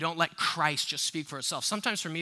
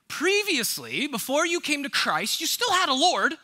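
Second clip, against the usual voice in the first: higher and louder, building fervor; rising preaching intensity; 9 semitones higher, 6 dB louder than usual. Real, not acted. Pastor pressing the congregation, rising fervent preaching. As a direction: climb in pitch as the point builds.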